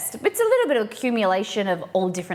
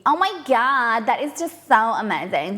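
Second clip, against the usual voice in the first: put-on Valley Girl accent; 3 semitones higher than usual. Streamer imitating LA girls for chat, Valley Girl accent. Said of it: "like, Valley Girl".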